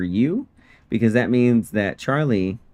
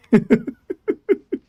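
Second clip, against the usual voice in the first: high laugh; 13 semitones higher, 4 dB louder than usual. Real, not acted. Laughs at joke about krill.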